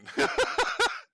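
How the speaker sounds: high, breathy burst of laughter. Real, not acted. Host amused by his own domain, laughing. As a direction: crack up at your own remark.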